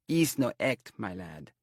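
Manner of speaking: put-on indignant seer in Romany accent. A narrator's reading. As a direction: say act with a thick Romany vowel. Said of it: "she said, act for act".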